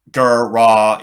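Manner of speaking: loud deliberate robot word; robot sounding out. Acted. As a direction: spell it out like a machine. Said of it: "sounding it out".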